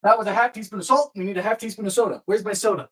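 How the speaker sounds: ordinary talk, nothing marked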